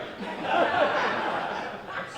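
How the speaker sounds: airy unvoiced laugh at his own joke; soft real laugh; breathy laugh. Real, not acted. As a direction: let a breathy chuckle out.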